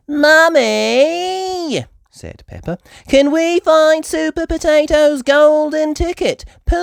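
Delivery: put-on high, loud little girl voice; eager piglet asking sweetly; Peppa. Peppa child voice.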